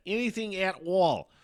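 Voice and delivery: hammed-up parody of how I always say it; mocking my own sign-off. Shown as a demonstration. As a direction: overdo the catchphrase in a sing-song. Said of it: "I fucking say it like that".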